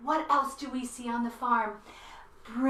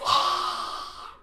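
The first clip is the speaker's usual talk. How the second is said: scary lizard hiss. Shown as a demonstration. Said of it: "let's be a scary lizard".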